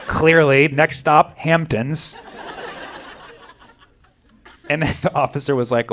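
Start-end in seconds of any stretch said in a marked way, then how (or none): none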